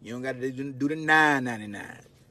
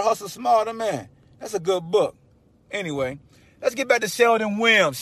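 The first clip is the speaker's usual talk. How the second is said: usual speaking voice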